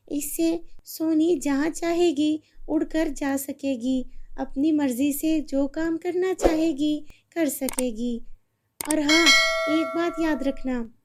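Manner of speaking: a fairy granting a wish; kind fairy. Acted. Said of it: "वो परी सोनी की माँ से कहती है".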